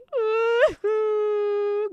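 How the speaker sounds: a high, bursting laugh. Real, not acted. Streamer laughing at his own paranoid bit.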